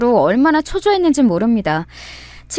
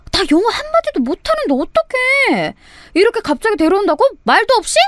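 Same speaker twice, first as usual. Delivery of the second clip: panicked friend; 9 semitones higher than usual. Acted. Friend panics over surprise foreigner.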